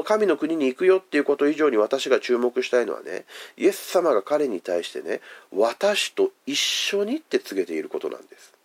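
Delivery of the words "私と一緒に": 「私と一緒に」 drawn out slowly and pitched higher; slow tender emphasis. Retold or imitated. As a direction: linger on 「私と一緒に」 like a promise. Preacher voices Jesus's promise.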